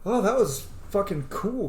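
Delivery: ordinary talk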